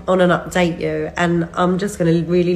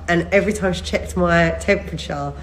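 ordinary talk, nothing marked

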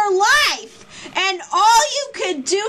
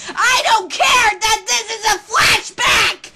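furious shout. Real, not acted. Fan raging at Light on screen, furious shout. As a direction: scream threats at the villain.

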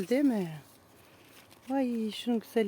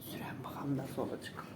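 whispering; 9 semitones lower, 14 dB quieter than usual. Real, not acted. Whispering before trying mask.

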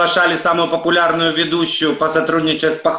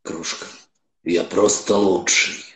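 much lower, soft seductive purr; seductive Don Juan; 7 semitones lower, 4 dB quieter than usual. Pretend. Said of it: "как Дон Жуан соблазнитель".

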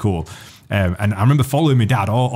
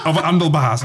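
normal talking voice

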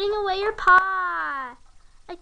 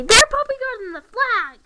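loud, higher indignant call-out; loud accusing call; 7 semitones higher, 8 dB louder than usual. Retold or imitated. Kid tattling on the other players, indignant loud call. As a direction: call it out to the whole table. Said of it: "then I'm allowed to say".

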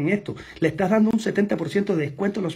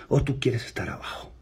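whisper; 3 semitones lower, 5 dB quieter than usual. Retold or imitated. Challenging prospect Juan.

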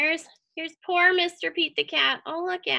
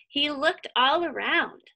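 ordinary delivery

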